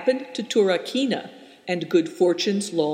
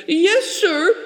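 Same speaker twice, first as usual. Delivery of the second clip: high, loud, crying yes sir; frightened reply; 13 semitones higher, 7 dB louder than usual. A narrator's reading. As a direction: answer in terror. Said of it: "and I answered".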